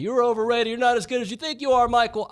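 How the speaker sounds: mock taunt. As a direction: jeer at him like a fan in the stands. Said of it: "probably chanting".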